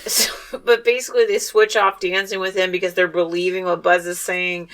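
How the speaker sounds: ordinary talk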